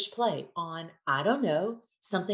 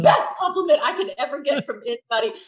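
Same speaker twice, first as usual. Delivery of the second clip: delighted exclamation; 2 semitones higher, 7 dB louder than usual. Retold or imitated. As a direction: gush over the compliment. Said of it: "I said".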